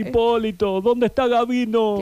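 put-on high, sweet old lady voice; Ana María impression. Impersonating Ana María greeting Hipólito. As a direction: coo it like a fond grandma. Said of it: "vamos con una Ana María".

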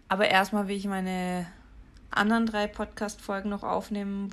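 usual speaking voice

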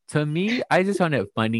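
normal talking voice

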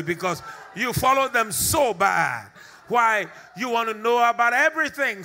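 loud, high, driving preacher's shout; preaching at full force. Acted. Preacher scolding the nosy generation in a rising shout. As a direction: hammer the point home to the crowd.

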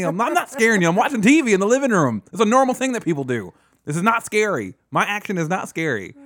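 high, loud mock self-defence; exasperated protest. Host retells defending himself to a jumpy friend, exasperated.

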